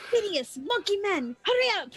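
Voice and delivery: high, urgent in-character shout; yelling out. Acted. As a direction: call into the tavern to rouse him. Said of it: "and I'll yell out".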